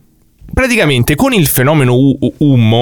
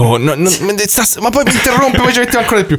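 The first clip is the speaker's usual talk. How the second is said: higher whiny protest; whiny complaint; 10 semitones higher than usual. Real, not acted. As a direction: whine about being interrupted. Complaining about interruptions.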